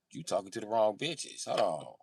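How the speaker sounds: ordinary talk, nothing marked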